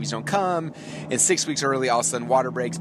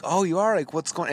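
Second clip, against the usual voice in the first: mock sympathy; 6 semitones higher than usual. Fake sympathy to tired cashier.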